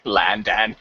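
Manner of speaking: a light mock Cockney "Landan" with a flat open a. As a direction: tease her with a Cockney "Landan". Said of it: "I can talk like that if I want".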